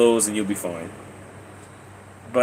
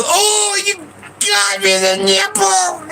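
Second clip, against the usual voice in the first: put-on high, slow wailing kid voice, pitched way up; whiny little brother; slower than usual. Retelling how his shot cousin cried out, high slow whine. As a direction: play the hurt little cousin whining up high. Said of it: "And he goes".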